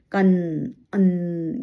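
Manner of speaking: teacher demonstrating a nasal N; nasal anusvara demo. Shown as a demonstration. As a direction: draw out the nasal N in कन and अन. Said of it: "यहाँ से नाक से आवाज निकलती है".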